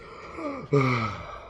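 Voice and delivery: heavy, exhaled creature breath; laboured breathing. Acted. Game master imitates the approaching thing, laboured breath. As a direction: breathe out heavily like something approaching.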